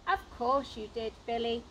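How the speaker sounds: plain reading voice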